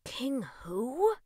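puzzled hushed question; puzzled wondering. A narrator's reading. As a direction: wonder it half to yourself. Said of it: "Russ wondered aloud".

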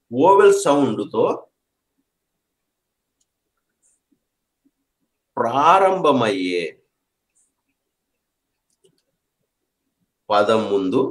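very slow, word by word with long pauses; slow dictation. Shown as a demonstration. Teacher slowing down so students note the rule, slow dictation. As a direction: spell the rule out slowly so students catch it. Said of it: "జాగ్రత్తగా వినండి".

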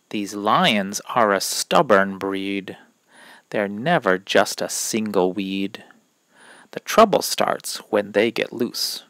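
ordinary delivery, nothing marked

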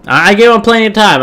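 exasperated. Real, not acted. Frustrated about waiting opponents.

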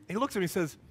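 usual speaking voice

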